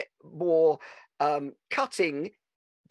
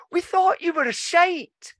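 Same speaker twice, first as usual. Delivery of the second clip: put-on high childish voice, deadpan put-down; squeaky kid voice knocking him down; wee cousins; 12 semitones higher, 4 dB louder than usual. Cousins deflating the pop star uncle, high child voice. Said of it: "and then went".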